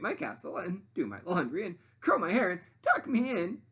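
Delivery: high, loud demanding sandwich voice; high bossy sandwich lady voice. Acted. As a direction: play the pushy sandwich, high and grand. Sandwich listing chores.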